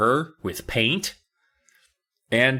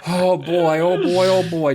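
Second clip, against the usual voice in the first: mock fretting; 6 semitones higher, 5 dB louder than usual. Acts out worried parents.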